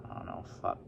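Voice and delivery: ordinary talk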